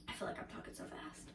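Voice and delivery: quick, hushed whisper; whispered aside. Real, not acted. Vlogger fretting over talking too fast, whispered aside.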